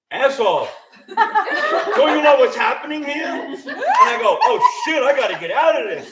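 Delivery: a loud inner voice jolting him into action; lizard survival brain. Acted. Lizard brain warns him of the ghost, urgent scolding. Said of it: "the little lizard survival brain in the back of my head goes".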